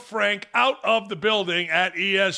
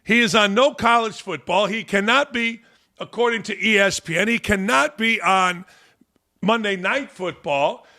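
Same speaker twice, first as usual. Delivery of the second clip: usual speaking voice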